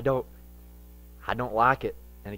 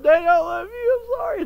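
high, tearful child's voice, mock sobbing; mock crying child; 7 dB louder than usual. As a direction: imitate a crying little kid saying sorry. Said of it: "they're crying too".

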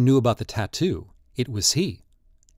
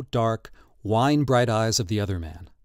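plain reading voice

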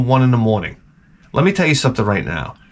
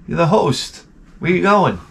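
mock protest; 6 semitones higher than usual. Guests question the host leaving.

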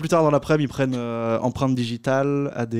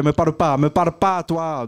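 mock angry warning; 5 semitones higher, 3 dB louder than usual. Mamadou voiced snapping at Italian.

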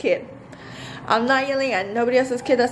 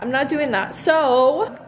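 normal talking voice

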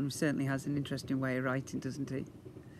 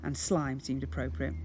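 usual speaking voice

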